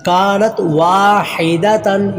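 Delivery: कानत वाहिदतन slowly, kaa and waa held; lengthened waw. Shown as a demonstration. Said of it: "वाव को लंबा करेंगे". (वाहिदतन का 'वा' लंबा)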